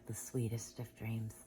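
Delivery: soft whispered goodnight. Real, not acted. Parent whispering goodnight to Aditi at bedtime. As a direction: breathe it tenderly at bedtime.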